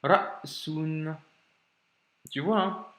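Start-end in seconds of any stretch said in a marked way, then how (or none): 0.0-1.2 "Ra'sun" cut dead after "Ra"; careful demo of "Ra'sun" with a silent catch; hamza blocked. Shown as a demonstration. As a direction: stop completely after "Ra", then say "sun". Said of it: "il y a un blocage avec absence de voyelle".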